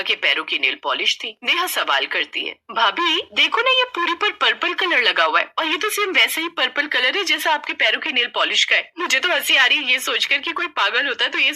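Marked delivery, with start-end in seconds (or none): 2.7-8.9 put-on higher girlish voice, pointing it out; high, chirpy sister-in-law; teasing young sister-in-law.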